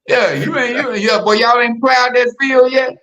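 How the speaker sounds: bossy field boss calling out; prison guard. Retold or imitated. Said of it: "You sound like the warden".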